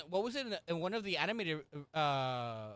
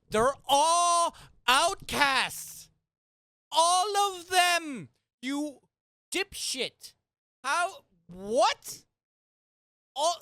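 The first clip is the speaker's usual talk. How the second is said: furious, slow, much louder shouting; rage moment; 9 semitones higher, 11 dB louder, much slower than usual. Real, not acted. Ranting to co-host about fans' outcast argument, furious slow shout. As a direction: bellow it at the fans in disbelief. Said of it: "Rage moment".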